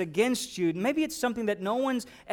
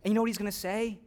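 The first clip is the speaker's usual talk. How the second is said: ordinary talk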